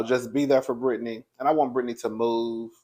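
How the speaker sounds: ordinary talk, nothing marked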